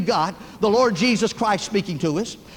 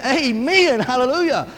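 mildly raised, imitated burst of praise; retold excited outburst; 2 semitones higher than usual. Uncle Roy bursts out while reading John three sixteen, retold excitedly. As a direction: act out a man who can't contain his praise. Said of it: "he couldn't help himself".

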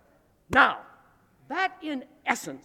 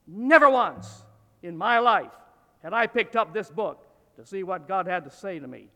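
ordinary talk, nothing marked

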